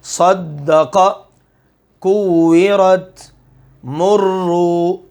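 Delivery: slow recitation with each doubled letter held firm; listen and recite slowly, pressing every shadda; tajweed recitation. Shown as a demonstration. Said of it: "سن لیجئے". (آہستہ تلاوت، ہر شد پر سختی)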